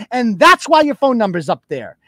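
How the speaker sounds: ordinary talk, nothing marked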